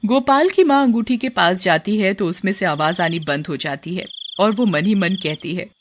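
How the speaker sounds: usual speaking voice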